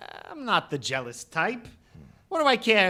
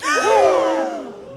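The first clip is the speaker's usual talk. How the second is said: high, loud startled gasp; shocked gasp; 9 dB louder than usual. Acted. Accused suspect gasps in shock at the detective, high loud gasp. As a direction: gasp as if caught red-handed.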